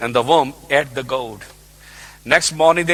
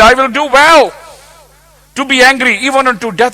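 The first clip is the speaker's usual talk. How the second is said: Jonah's defiant reply, higher and louder; stubborn prophet; 10 semitones higher, 9 dB louder than usual. Acted. Jonah answering God, defiant and loud. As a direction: throw it back in God's face.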